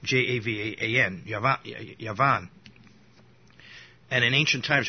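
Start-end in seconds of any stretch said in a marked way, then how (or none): 0.0-1.2 J A V A N letter by letter, slowly; spelled out. Shown as a demonstration. Teacher spelling the name for listeners, J A V A N letter by letter. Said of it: "in American pronunciation". 1.3-2.5 Yavan starting with a Y sound; Hebrew pronunciation. Shown as a demonstration. Teacher repeating the Hebrew name, Yavan with a Y. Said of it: "In Hebrew, the word for".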